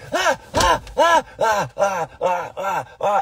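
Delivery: hammed-up freaking-out child; imitated panicked yelps. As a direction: yelp repeatedly like a kid dreading a spanking. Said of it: "freaking out".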